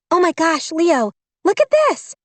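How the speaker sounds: put-on high, thrilled young girl voice; excited girl. A narrator's reading. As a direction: gasp it out with delight. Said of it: "bright with excitement".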